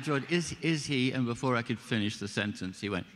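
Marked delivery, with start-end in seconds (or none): 0.0-1.1 high, halting, tentative question; halting and pitched up, feeling out the director; hesitant hopeful query. Retold or imitated. Actor sounds out George about his character, hesitant and hopeful.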